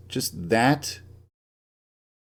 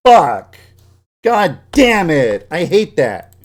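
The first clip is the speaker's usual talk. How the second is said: much louder, higher real angry shouting; 5 semitones higher, 8 dB louder, slower than usual. Real, not acted. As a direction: bark the curses out in rage. Raging at editing glitch.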